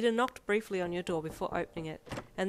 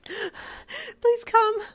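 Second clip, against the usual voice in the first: high, loud sobbing plea; panicked wail down the phone; desperate plea; 10 dB louder than usual. Acted. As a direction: beg tearfully through gasps. Panicked caller begs for rescue.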